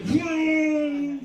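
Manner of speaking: high, loud knowing hum; a kid pretending to think hard; mock daughter hmm. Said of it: "she's just like".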